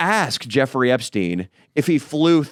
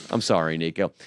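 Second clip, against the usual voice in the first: ordinary talk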